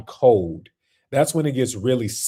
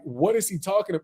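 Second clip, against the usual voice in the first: ordinary talk, nothing marked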